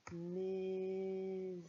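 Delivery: MAISON stretched very slowly on a level pitch; slow, held-out syllables in MAISON; sounding out. Shown as a demonstration. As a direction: draw out each sound of MAISON as you write. Sounded out while writing it.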